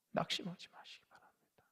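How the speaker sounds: whispered encouragement; soft whisper. Real, not acted. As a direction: breathe it gently to the flock. Pastor comforting congregation softly. (속삭이듯 낮게)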